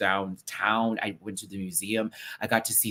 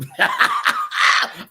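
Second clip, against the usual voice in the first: airless high wheeze of a laugh; breathless wheezing laugh; 10 dB louder than usual. Real, not acted. Laughs at own jab.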